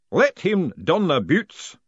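slow, loud ceremonial order; drawn-out master of ceremonies; booming ritual voice. A narrator's reading. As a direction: intone it grandly and slowly. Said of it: "the voice went on".

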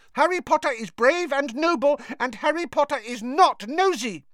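high elf voice scolding firmly; a touch of elf indignation; angry. A narrator's reading. Dobby scolds Winky for rudeness to Harry, angry.

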